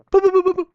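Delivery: high, very loud boos; cartoon mind-control beeping; imitated telepathy signal. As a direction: send a silly high-pitched animal signal. Imitates signal commanding animals.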